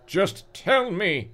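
high, slow desperate plea; beg, stretching the words; hammed-up pleading. A narrator's reading. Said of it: "Brendan pleaded".

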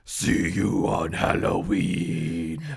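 low, slow rasping pumpkin voice; jack-o'-lantern. Acted. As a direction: rasp it like a monster. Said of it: "the jack-o'-lantern rasped".

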